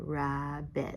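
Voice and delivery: Rabbit softly in two beats, "Rab - bit"; split into syllables. Shown as a demonstration. Teacher showing syllables with the chin, softly split. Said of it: "we've done this with our chin".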